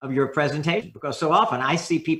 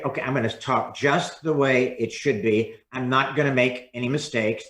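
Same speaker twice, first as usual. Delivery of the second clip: constricted monotone; 4 semitones lower than usual. Shown as a demonstration. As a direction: sound like you're reading carefully to avoid mistakes. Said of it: "you see what's happening to my voice".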